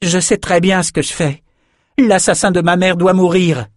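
high-pitched, vengeful retort; angry defiance. A narrator's reading. Young magician confronts her mother's killer, angry and defiant. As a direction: throw it back at him, high and hard. Samantha defies Richard, vowing revenge.

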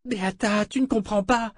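put-on high pleading young man; desperate protest. A narrator's reading. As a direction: beg to be understood. Fitch pleads with a doubting friend.

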